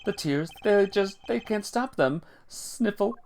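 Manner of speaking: high, wistful, hammed-up tearfulness; sentimental old chairman. Old man recalls his youth and a lost rival, mock-tearful high voice. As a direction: play a weepy old man, reading the sniffles as words.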